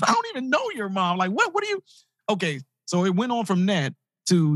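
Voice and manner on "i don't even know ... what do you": high, bewildered banker voice; mock the flustered banker, pitched way up; startled banker. Banker answers the kid's accusation in a high, bewildered voice.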